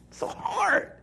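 high, wailing fake cry; hammed-up whimper of despair; mock sob. Preacher mocks believers struggling to know God's will with a fake sob.

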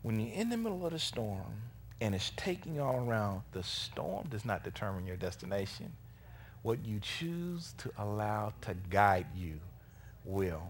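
very soft, low sermon voice; hushed preaching. Real, not acted. Preacher counselling congregation on the storm, hushed preaching. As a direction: bring it right down, grave and quiet.

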